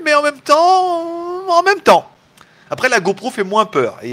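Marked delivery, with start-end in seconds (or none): 0.0-2.1 very high, slow, stretched-out words; high drawn-out whine. Acted. Repeats phrase in stretched voice.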